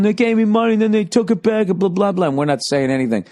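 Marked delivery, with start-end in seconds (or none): 0.0-2.3 high complaining yell; high aggrieved mock shout. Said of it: "the kid's going / as he's yelling".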